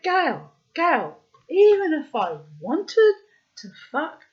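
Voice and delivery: loud, high exasperated rant; acted-out indignant tirade; outraged refusal. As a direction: refuse loudly, building to disbelief.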